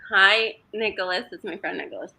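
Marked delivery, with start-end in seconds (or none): none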